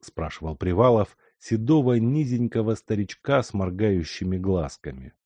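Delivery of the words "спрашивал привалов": usual speaking voice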